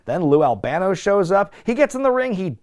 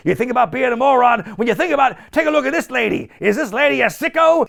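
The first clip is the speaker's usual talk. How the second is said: fast, higher mock rant; Albano ranting; 7 semitones higher, 4 dB louder, faster than usual. Imitating Albano insulting the Blackhearts' manager, fast high mock rant. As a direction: rattle off insults.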